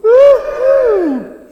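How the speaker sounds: high, loud cheer; cheering woohoo. Shown as a demonstration. Children's talk leader demonstrating a cheer, loud whoop. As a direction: cheer like a teammate took a mark. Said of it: "Grown-ups".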